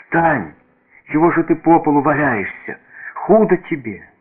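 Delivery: put-on high, urgent child's plea; girl crying out. A narrator's reading. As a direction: plead in alarm for him to get up. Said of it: "закричала она".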